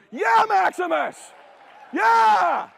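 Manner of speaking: high, loud, drawn-out cheer; whooping victory shout; joyful shout. Retold or imitated. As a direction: yell it like your kid just sank the putt. Celebrating son's birdie putt.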